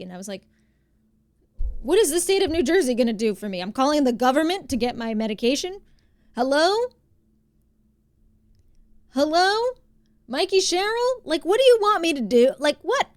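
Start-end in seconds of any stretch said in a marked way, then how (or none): 1.8-13.1 loud, incredulous venting, pitch climbing; exasperated rant. Retold or imitated. Retelling the call with the insurance rep, loud exasperated disbelief. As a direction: throw up your hands at the absurdity.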